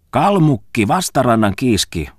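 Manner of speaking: high, loud teasing name-calling; joking scolding. A narrator's reading. Muttinen calls after departing Falk, scolding him in jest. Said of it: "Muttisen pilailevaa torumista".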